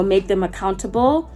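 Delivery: ordinary delivery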